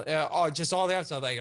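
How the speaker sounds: usual speaking voice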